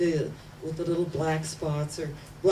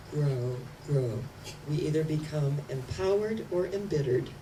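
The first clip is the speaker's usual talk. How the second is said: usual speaking voice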